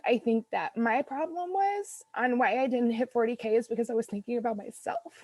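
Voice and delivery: ordinary delivery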